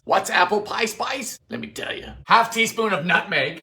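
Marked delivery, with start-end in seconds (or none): 1.5-2.1 hushed secret tone; whisper. Acted. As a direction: lean in and whisper it. Whispered aside.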